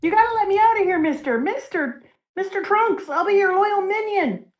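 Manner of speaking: high, pleading villain whine; whiny begging voice. Retold or imitated. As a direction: beg to be let out. Caribou begging from the barrel.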